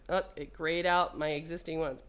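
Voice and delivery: ordinary delivery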